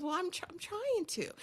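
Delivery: small high voice, stammering softly; meek flustered protest. Retold or imitated. Woman answers the man yelling at her, meekly flustered. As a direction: mimic a timid, stumbling reply.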